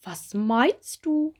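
slow, stretched cricket voice; surprised cricket Gisela. Acted. Cricket Gisela puzzled by the bumblebee's question, slow and drawn out. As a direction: draw it out, astonished. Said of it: "fragt Gisela Grille erstaunt".

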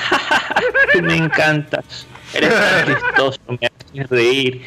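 high, laughing mock flirt; imitating a woman laughing at everything her date says; giggly flirting. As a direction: giggle through it like a woman flirting with a rich tourist. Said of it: "se reía por todo".

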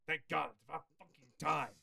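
loud, fast mock relief; loud relieved shout. Customer finally called up, loud fast relief. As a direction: blurt it out loudly.

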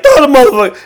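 loud, high laughing yell. Retold or imitated. Fan yelling at the screen mid-game, loud and high with a laugh. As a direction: bark it like a fan losing it. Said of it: "I was like".

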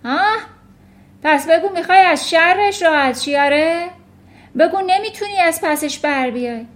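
loud, high, scornful accusing; snorting accusation. A narrator's reading. Headmistress accusing teacher of dumping the girl, snorting scorn. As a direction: pile on the accusation loudly. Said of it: "خورناسکشون گفت".

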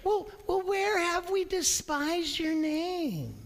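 high, whining mock-innocent voice; mocking whiny priests. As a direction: play the offended priest in a high whine. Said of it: "this is what the priests were saying".

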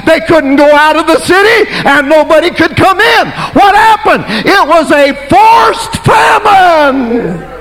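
high, ringing preacher's cry; full-force preaching. Acted. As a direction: thunder it out to the pews.

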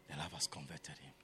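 a hushed whisper to the church; whispered conviction. Shown as a demonstration. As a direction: whisper it, fast and breathless.

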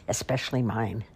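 ordinary delivery

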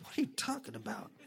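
unvoiced incredulous aside; whisper. Real, not acted. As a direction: whisper it in disbelief. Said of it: "disbelief at soap making".

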